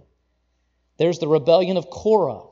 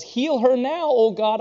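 higher, pressing plea; urgent raised prayer; urgent cry to God; 8 semitones higher, 4 dB louder than usual. Acted. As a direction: beg for healing right now. Moses crying out for Miriam.

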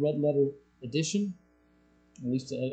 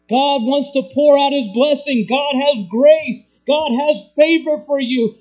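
loud, high preacher's call; full-force preaching; 8 semitones higher, 9 dB louder than usual. Acted. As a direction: preach it out to the whole room.

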